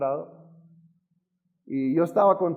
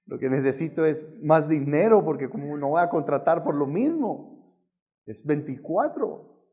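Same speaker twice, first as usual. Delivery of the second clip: louder, rising objection to God; exasperated protest; 2 semitones higher, 4 dB louder than usual. Retold or imitated. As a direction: argue back in disbelief, piling up reasons.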